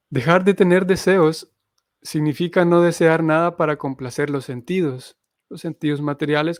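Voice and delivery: reading the purport aloud for students; reading scripture aloud. Acted. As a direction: read the passage out to the class, deliberately.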